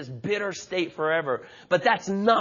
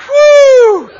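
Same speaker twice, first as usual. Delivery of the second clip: mock cheer, high and loud; big high celebratory whoo; excited whoop; 18 dB louder than usual. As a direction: whoop it like a rowdy fan in the pews. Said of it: "Some of you are like".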